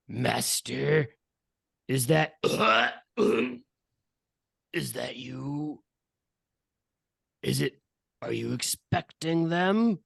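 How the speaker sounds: halting creature voice with throat noises; hesitant minion. Acted. Minion asks if master is there, halting and slow. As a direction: grope slowly through the question.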